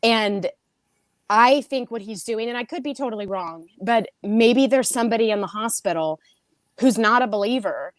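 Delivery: ordinary talk, nothing marked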